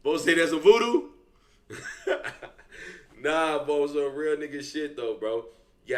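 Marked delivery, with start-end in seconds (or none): 1.7-2.5 high laugh. Real, not acted. Reacting to boss remark.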